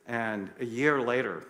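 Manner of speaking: usual speaking voice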